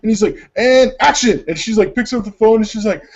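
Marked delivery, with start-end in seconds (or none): 0.6-1.4 high, loud mock director's call. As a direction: call the take to start, hammed up. Director calls action in sketch.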